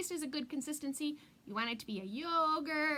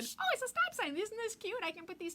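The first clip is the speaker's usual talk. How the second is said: mock excited; 10 semitones higher than usual.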